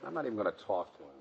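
low, hushed, quick grumble; sulky aside. Acted. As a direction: mutter it quickly under your breath. Sulking aside as he approaches.

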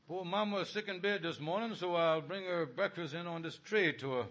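ordinary talk, nothing marked

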